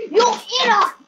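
loud, high insult; angry gamer yell. Real, not acted. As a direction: yell it sharply at another player. Yelling at another player.

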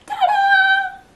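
high, loud ta-da cry; excited ta-da. Real, not acted. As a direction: shout it out like a big reveal. Jelly reveal, said twice.

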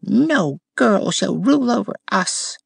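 high, scornful rose voice. A narrator's reading. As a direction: sneer it down at the girl. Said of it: "with the voice of scorn".

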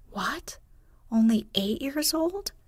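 put-on breathy what, then a sharp high rise on old; lightly voiced outrage at the age; indignant exclamation. A narrator's reading. Housekeeper indignant at the little girl's age, mock outrage. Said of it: "exclaimed indignantly".

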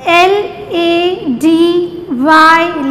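letters spelt out slowly one by one; slow spelling drill. Shown as a demonstration. Teacher spelling a word slowly for children to repeat. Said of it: "You have to repeat every word twice with me".

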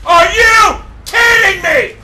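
high, loud, slow outrage; incredulous shout. Real, not acted. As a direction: yell in real disbelief. Gamer in disbelief.

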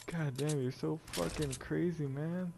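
ordinary delivery

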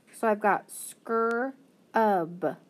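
very slow skur-ub pulled apart; sounding out. Shown as a demonstration. Teacher to students, slowly sounding out scrub. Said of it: "blend that together and say shkur".

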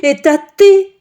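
very loud, high sputter; sputtering outrage. Acted. The friend sputtering at Ksenia. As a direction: splutter with indignation. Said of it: "підскочила подруга свекрухи".

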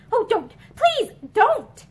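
high, loud, desperate plea; note of hysteria. A narrator's reading. Pansy begs Ramsden to stop, hysterical high plea. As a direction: break in with a rising, near-hysterical cry. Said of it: "a hysterical note in her voice".

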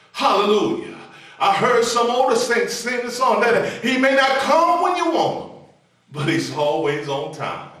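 loud, high, rhythmic sermon climax; full-force preaching. Acted. Preacher exhorting the congregation in full cry. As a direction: preach it out to the congregation, rising and pounding.

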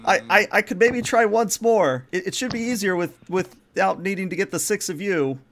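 high-pitched, flustered crewman; timid, pleading underling; nervous stammering. Acted. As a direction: stammer an anxious offer to retry. Jensen nervously offers another try.

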